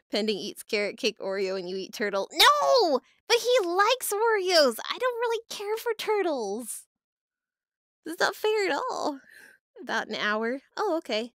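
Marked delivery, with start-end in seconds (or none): 2.3-9.2 hammed-up childish objection; whiny protest. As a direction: wail that you're being wronged. Protesting unfair eating challenge.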